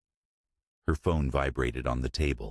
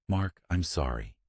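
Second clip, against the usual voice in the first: cracking whisper; 3 semitones higher than usual. A narrator's reading. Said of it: "she whispered, her voice cracking".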